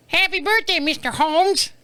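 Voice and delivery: high, put-on greeting voice; mock well-wisher. Stranger wishing his dad happy birthday, mocking high voice. Quotes someone who never met dad.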